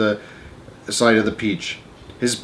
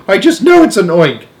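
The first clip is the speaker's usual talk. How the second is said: loud, high, insistent yell; panicked scream; 8 semitones higher, 6 dB louder than usual. A narrator's reading. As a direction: shout it with alarmed conviction. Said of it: "screamed the head of the fire department".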